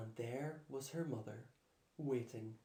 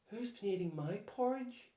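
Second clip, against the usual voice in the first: a little higher, motherly bear voice; lightly voiced mother bear; Mummy Bear voice; 8 semitones higher than usual. A narrator's reading. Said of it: "said Mummy Bear".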